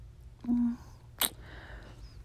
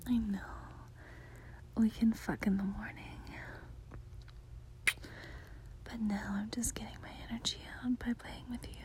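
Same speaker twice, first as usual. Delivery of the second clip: whispering; 10 dB quieter than usual. Acted. Whispers throughout, intimate.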